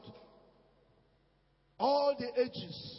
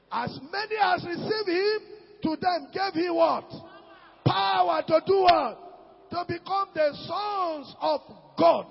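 preaching at full force; 6 semitones higher, 7 dB louder than usual. Acted. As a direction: call it out like a revival preacher. Preacher shouting to the congregation.

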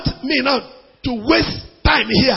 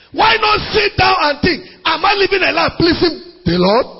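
fiery preaching; 4 semitones higher than usual. Acted. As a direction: hammer the point home to the congregation. Preaching at full force.

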